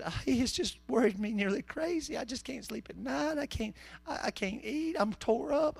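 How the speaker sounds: put-on high, worn-out old lady voice; Granny King. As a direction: imitate a fretful granny pouring out her troubles. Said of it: "She said, she said".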